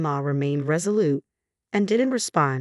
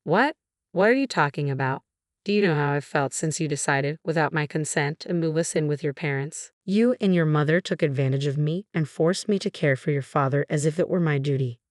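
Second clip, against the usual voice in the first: plain reading voice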